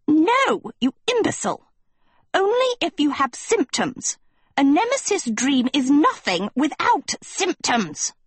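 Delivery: high, scornful teacher snapping; sharp retort. A narrator's reading. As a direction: bite back at the fool. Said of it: "Lady Lesso retorted".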